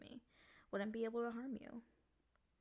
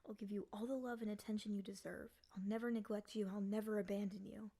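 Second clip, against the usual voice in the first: ordinary talk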